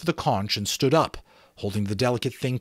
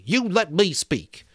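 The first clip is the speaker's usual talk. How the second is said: indignant; 9 semitones higher, 3 dB louder than usual. A narrator's reading. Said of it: "Piggy said indignantly".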